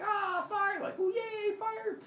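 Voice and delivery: very high mock squeals; hammed-up giddy excitement; freaking out. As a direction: squeal like an overjoyed crowd. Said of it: "they freak out, like".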